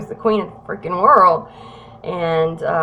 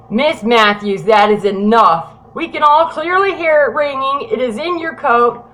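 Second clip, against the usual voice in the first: loud, exasperated acted command; forceful retold scolding, voice raised; fed-up officer; 4 semitones higher, 6 dB louder than usual. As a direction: play a cop who has had enough. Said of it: "getting fed up with me".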